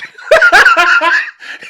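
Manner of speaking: loud, high burst of laughter; real laughter. Real, not acted. Host cracking up at co-host's interruption, laughing. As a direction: crack up loudly.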